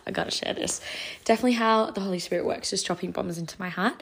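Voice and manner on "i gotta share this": breathy whisper to herself; whispered thought. Retold or imitated. Remembering a sudden idea, whispered inner voice.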